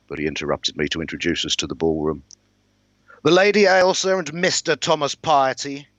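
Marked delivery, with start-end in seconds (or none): 3.2-5.9 proclaiming herald. Acted. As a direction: announce the guests in a much higher voice. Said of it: "he proclaimed".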